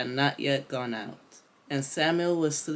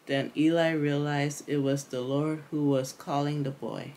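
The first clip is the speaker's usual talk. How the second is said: plain reading voice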